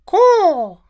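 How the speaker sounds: high raspy caw; crow caw. Acted. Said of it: "The crow let out a".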